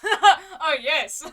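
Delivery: loud, high laugh on the words; real laughing outburst. Real, not acted. Streamer cracks up reading the game's dialogue, laughing loudly. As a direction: crack up while exclaiming.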